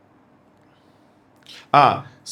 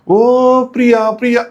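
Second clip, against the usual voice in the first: very slow, higher, stretched lovesick call; mock filmy lover; 5 semitones higher, much slower than usual. Vlogger parodying the lovers like Devdas, hammed-up love call. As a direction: ham up a tragic movie hero.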